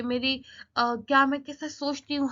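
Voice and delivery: ordinary talk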